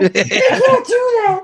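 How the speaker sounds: very high, slow mock protest; devastated little kid. Little kid protesting the hero's death, high mock wail. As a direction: whine it like a heartbroken child, half laughing. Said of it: "Some little kid falls to his knees".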